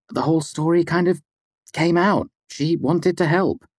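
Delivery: high, apologetic female voice; placating woman. A narrator's reading. Mola explains Devi wanted to help, high and placating.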